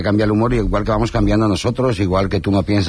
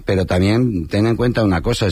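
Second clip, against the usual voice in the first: normal talking voice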